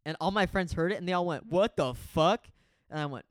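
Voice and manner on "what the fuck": loud, slow, drawn-out disbelief; shocked outcry. Retold or imitated. Friends overhearing the live, loud drawn-out shocked imitation. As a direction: imitate a whole group's stunned outburst.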